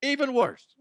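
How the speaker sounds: high, loud hammed-up whoa; mock shock. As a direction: act scandalized by the clip. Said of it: "you're like".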